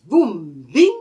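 loud sound-effect boum bing; boom bing sound. A narrator's reading. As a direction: call out the magic whoosh. Magic flight sound.

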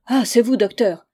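loud, relieved recognition. A narrator's reading. Frightened pensioner recognises his doctor, relieved. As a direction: call out with relief. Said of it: "avec un soupir de soulagement".

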